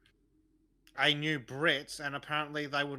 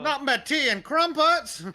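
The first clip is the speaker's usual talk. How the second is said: hammed-up English accent, much higher and louder, slow; outraged mock Brit; 12 semitones higher, 12 dB louder than usual. Co-host mocking Brits losing their tea, shrill mock English outcry. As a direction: wail it like a scandalised Englishman.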